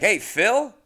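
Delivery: high, loud questioning hail; mock driver greeting. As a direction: imitate a driver chirping the wrong name. Imagined driver calls wrong name.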